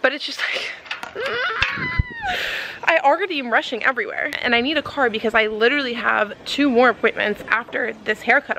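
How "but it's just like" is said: a high shrieking laugh of frustration; half-laugh, half-scream at the broken gate; dramatic laughing shriek. Real, not acted. Stuck behind a beeping gate, shrieking with laughter. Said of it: "I know I'm being really dramatic".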